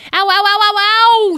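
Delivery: string of high, loud ows; high pained yelping; yelling in pain. A narrator's reading. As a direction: yelp over and over as you get clawed. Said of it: "she yelled as the kitten clawed".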